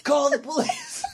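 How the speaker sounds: laughing through a high panicked plea; high mock panic. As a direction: squeal it like a hammy drunk. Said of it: "It's like".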